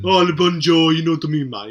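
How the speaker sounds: loud mock Brummie with pidgin French; hammed-up Brummie lad saying bonjour; brummy accent. Friend riffs on the bad French accent, loud mock Brummie. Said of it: "really brummy accent going like".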